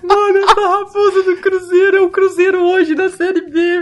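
high-pitched helpless laughter through words; crying with laughter; laughing hard. Real, not acted. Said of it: "eu tô dando risada".

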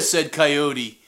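plain reading voice

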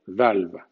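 "valve" con la L sonando; English "valve". Shown as a demonstration. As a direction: say valve with a clear L. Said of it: "suena la L". (di "valve" pronunciando la L)

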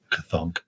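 low soft "ka-thunk" car door sound; heavy clunk. Retold or imitated. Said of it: "it goes".

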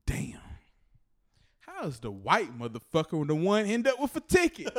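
loud, indignant imitation of a friend; hammed-up outraged griping; mock pissed-off. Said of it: "he was pissed".

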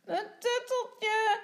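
put-on slow, high, loud toddler wail; tearful little boy. A narrator's reading. Tommy cries again for his comforter, wailing. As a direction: drag out a child's tearful whine. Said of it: "huilt Tommy wo-, weer".

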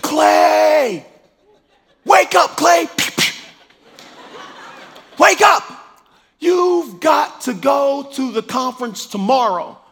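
high, loud calls to wake up; excited wake-up call. Retold or imitated. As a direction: shout him awake, thrilled. Wife waking him, excited.

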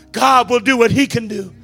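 ordinary talk, nothing marked

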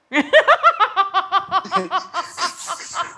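high giddy laugh acting out his reaction. Contestant acting out his laughing reaction, high giddy laugh. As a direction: burst into a surprised high laugh. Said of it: "A minha reação foi".

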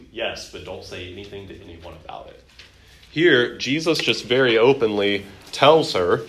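usual speaking voice

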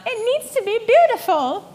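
a hammed-up demand that it be beautiful. To husband Jake about house hopes, high hammed-up insistence. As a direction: pitch way up, mock insistence.

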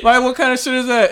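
can't believe this nonsense; exasperated outburst at fans; incredulous. Real, not acted. Incredulous rant about Zeon fans.

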